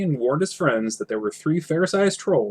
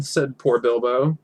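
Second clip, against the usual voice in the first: plain reading voice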